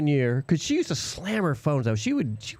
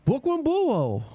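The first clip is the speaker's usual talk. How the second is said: put-on Brooklyn accent, higher, Buo for borough; Brooklyn accent imitation; 9 semitones higher, slower than usual. Retelling a coworker's Brooklyn accent. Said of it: "that's how she talked".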